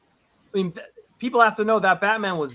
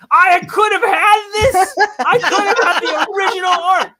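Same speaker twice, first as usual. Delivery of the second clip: laughing lament; 13 semitones higher, 9 dB louder than usual. Real, not acted. Telling the interviewer about the sold cover, laughing wail. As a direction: laugh through real regret at a higher pitch. Regret over the sold art.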